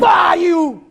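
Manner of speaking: very high, loud outburst at a pest; sudden yell. Real, not acted. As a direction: yell out as you take a swat. Sudden yell swatting at a fly.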